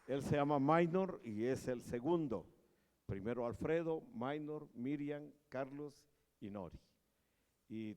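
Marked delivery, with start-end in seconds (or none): none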